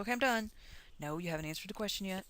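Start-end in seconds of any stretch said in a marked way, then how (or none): 0.0-0.5 higher, louder mock student declaring it finished; smug student. Teacher imitating a student who stops too early, mock satisfied. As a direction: play the student who thinks the work is over. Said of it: "you go".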